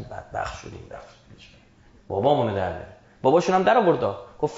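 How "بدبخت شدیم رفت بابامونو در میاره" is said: acted panicky whisper, dropping very low; hushed dread. Calf worshippers to each other about Moses, hushed dread. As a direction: murmur it like culprits in trouble.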